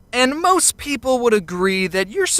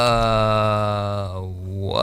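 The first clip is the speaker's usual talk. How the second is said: very low, baffled drawl; groggy confusion; 12 semitones lower than usual. Acted. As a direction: answer low and slow, just woken up. Groggy answer to baffling caller.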